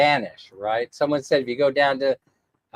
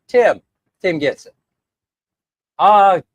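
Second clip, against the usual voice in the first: normal talking voice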